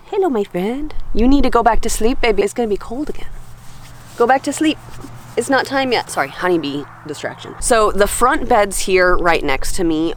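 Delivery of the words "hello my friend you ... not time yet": high sing-song coo to a bee. Real, not acted. Talking baby-talk to a bee.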